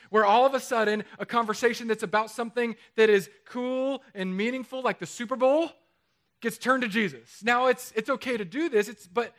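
usual speaking voice

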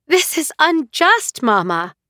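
high, slow indignant outburst; bursting out. Acted. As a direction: let the protest burst out of her, high and slow. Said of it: "Nahal burst out".

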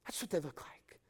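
ordinary delivery

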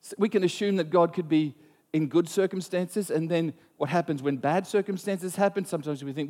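ordinary talk, nothing marked

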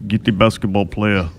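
low, sinister Russian accent; Russian accent, menacing boss; Russian villain accent. Shown as a demonstration. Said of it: "Like that?".

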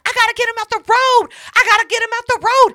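high, loud, urgent repetition; frantic mock yell. Woman desperate to clear the road, in a frantic high mock yell. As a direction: imitate someone panicking at full voice.

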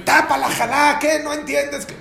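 high, loud mocking nag; mock scolding. Son scolding his father about covering the challah, high mock nag. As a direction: snap at the father in a put-on nagging voice. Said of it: "y le dice a su padre".

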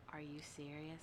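hushed, imitated stunned question; retold disbelief. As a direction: softly voice his disbelief. Retells his stunned, repeated question.

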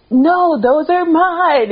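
high, slow, hammed-up insistence; mock possessive protest. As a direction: drag it out like a whiny possessive friend. Acting friend's angry beer quarrel.

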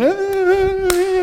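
mocking the cheerful crowd with silly squeals; mock happy cheering. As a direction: squeal like a giddy happy clapper. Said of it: "as if everything's lovely and happy".

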